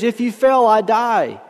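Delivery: high-pitched pleading, no real tears; mild tearful plea. A narrator's reading. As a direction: beg with life at stake, understated. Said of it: "shouted back in tears".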